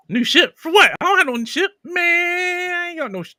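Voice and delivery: high, loud, incredulous yelps; indignant disbelief. Real, not acted. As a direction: protest like the idea is absurd. Baffled by a chat comment.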